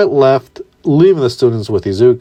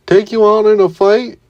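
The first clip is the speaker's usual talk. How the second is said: high startled question; 9 semitones higher than usual. Acted. Mineta startled at Izuku, high and incredulous. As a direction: jump up in pitch, caught off guard. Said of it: "Mineta startled".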